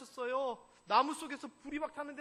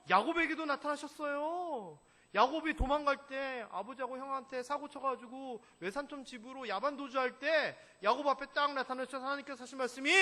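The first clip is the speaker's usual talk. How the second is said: ordinary talk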